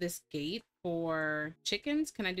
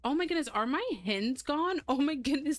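high, startled disbelief; alarmed shock; 5 semitones higher than usual. Real, not acted. Player finding the hens are gone, real alarmed shock. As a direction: gasp out rising dismay. Discovers fox killed her hens.

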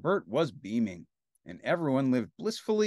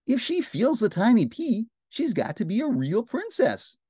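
high, emphatic queen voice; storybook queen, pitched high; scheming queen; 10 semitones higher, 4 dB louder than usual. A narrator's reading. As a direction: declare the test in high royal tones. Queen tells her plan.

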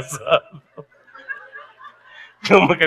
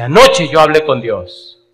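slow, louder solemn declaration; solemn lady; 4 semitones higher, 7 dB louder, slower than usual. Retold or imitated. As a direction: announce it slowly and gravely. Lady's solemn revelation. (señora declarando con solemnidad, despacio)

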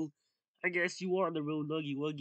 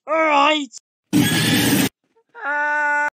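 loud battle cry; 9 semitones higher, 14 dB louder, much slower than usual. Acted. Fight climax, loud cry.

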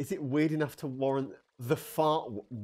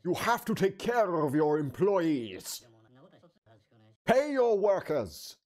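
character voice; 5 semitones higher, 4 dB louder, slower than usual. Acted. Reading game character lines.

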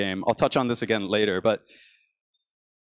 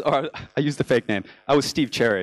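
normal talking voice

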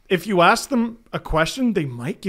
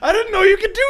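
high-pitched mock disbelief; incredulous fan, playfully exaggerated; retold astonishment; 5 dB louder, faster than usual. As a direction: relive the shock, pitch shooting way up. Retelling reaction to changed score.

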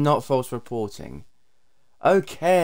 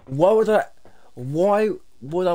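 raging gamer; 6 semitones higher, 4 dB louder than usual. Real, not acted. Ranting outburst.

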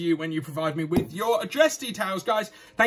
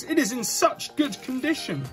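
usual speaking voice

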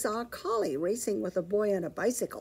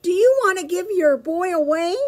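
calling out politely; 10 semitones higher, 6 dB louder than usual. Acted. Petey calling out to the collie, high and polite. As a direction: call across to the dog, sweet but loud. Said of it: "He called out to the collie".

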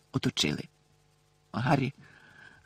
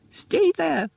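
much higher, desperate cry; urgent shout. A narrator's reading. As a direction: call out to stop the spells. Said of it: "пролунав раптом знайомий голос". (батько кричить, щоб зупинити чаклунів: відчайдушний крик)

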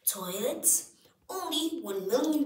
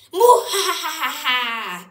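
evil villain cackle; 6 semitones higher, 14 dB louder than usual. Acted. Villain laughs.

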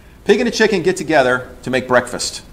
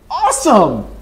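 sarcastic awesome; 7 semitones higher, 5 dB louder, slower than usual. Real, not acted. Sarcastic praise of weekly failures.

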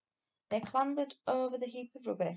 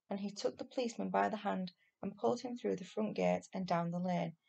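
ordinary delivery

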